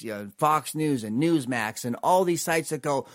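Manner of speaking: ordinary talk, nothing marked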